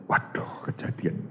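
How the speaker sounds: hushed, breathless gasp of dismay; shocked whisper. Retold or imitated. Shocked husband hearing the phone message, whispering. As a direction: whisper it as if the news just hit you.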